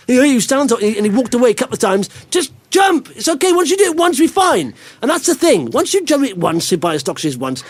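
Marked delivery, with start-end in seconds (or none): 2.3-4.7 high-pitched, insistent encouragement; urging on. Retold or imitated. Dad to his son too scared to jump, urging him on. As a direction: coax them over the edge, pitched well up.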